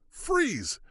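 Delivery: high hollered command. Acted. The other marshal orders the clerk, high holler. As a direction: holler it, gun drawn. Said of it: "the other marshal hollered".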